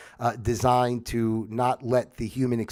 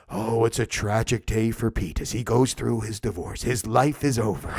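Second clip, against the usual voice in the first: lower, lightly voiced doom bulletin; mock tragic newsreader; 3 semitones lower than usual. Mocking anchor doom voice used on a grieving friend. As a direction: announce a friend's divorce like tragic news. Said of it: "You're not gonna come to them and go".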